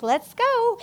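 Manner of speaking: high, loud, eager call to get moving. Retold or imitated. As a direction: chirp it out like you can't wait. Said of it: "I'm like".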